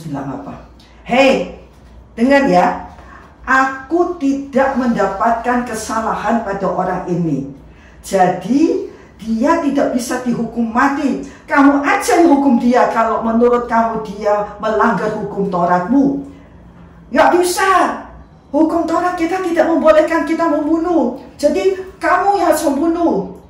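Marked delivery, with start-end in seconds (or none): 1.1-16.3 louder, commanding address; firm public declaration. Acted. Pilate addressing crowd. 17.1-23.4 much higher, loud protesting; crowd refusing loudly. Acted.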